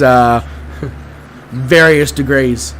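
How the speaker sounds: ordinary talk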